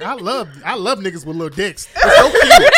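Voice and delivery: laughing impression of a sugary put-down; mock backhanded compliment. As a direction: coo it like a fake-sweet backhanded compliment. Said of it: "They gonna give you some backhanded compliments".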